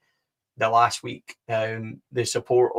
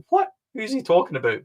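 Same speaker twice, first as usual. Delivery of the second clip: retold alarmed surprise; 8 semitones higher than usual. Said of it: "And I was like".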